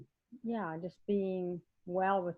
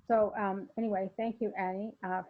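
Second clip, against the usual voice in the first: ordinary delivery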